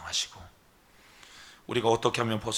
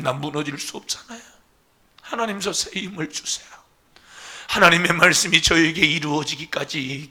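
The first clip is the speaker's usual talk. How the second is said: earnest crying-out prayer to God; pleading prayer; 5 semitones higher, 7 dB louder than usual. Acted. Pastor modeling prayer for the church, earnest plea. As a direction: pour out the heart to God.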